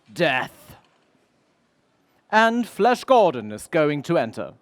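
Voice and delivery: normal talking voice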